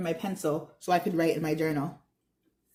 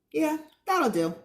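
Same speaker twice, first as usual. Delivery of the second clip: higher, Ella amused; 7 semitones higher than usual. Acted.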